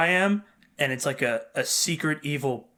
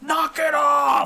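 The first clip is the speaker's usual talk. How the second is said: slow, high, shouted dad order; hammed-up dad shouting; mock angry dad; 11 semitones higher, 5 dB louder, much slower than usual. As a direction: bark at the kids like an annoyed dad. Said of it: "My dad's like".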